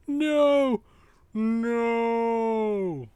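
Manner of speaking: high, loud protesting no; mock cry of no. Player correcting himself, voicing a high dismayed protest. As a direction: shout it like a dismayed character. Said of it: "Sorry, should be".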